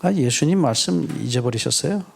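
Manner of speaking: ordinary talk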